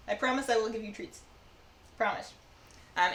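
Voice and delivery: ordinary delivery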